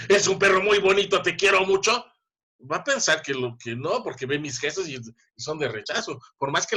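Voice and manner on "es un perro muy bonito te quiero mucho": louder, higher harsh yell over loving words; yelling at the pet. Retold or imitated. Teacher to her pet, mock angry yell. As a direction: bark kind words at the dog angrily. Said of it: "si yo le grito y le digo".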